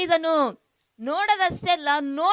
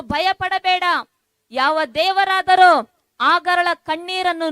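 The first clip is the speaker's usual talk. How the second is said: rising, insistent preacher's call, slightly louder; fervent preaching; 3 semitones higher, 4 dB louder than usual. Real, not acted. Pastor urging his worried listeners not to fear, fervent preaching. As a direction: preach it out to the hurting congregation, building.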